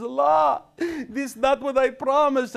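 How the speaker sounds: high, slow wail; whining bin Laden. Retold or imitated. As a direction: complain like a cheated martyr. Bin Laden's complaint in joke.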